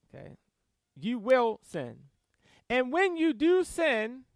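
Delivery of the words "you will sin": slow, deliberate stress on "You will sin"; emphatic. Shown as a demonstration. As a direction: repeat "You will sin" firmly, giving each word weight. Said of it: "But it's emphatic here".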